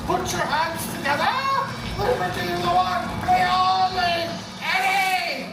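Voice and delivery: booming host announcement; announcer shout. Acted. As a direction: hype up the crowd loudly. Show host announcing Eddie.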